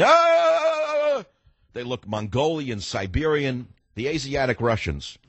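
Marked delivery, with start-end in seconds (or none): 0.0-1.2 a high, long war cry; retold soldiers' battle yell, high; mock battle scream. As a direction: scream like charging troops.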